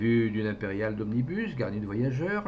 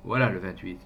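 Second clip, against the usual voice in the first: ordinary delivery, nothing marked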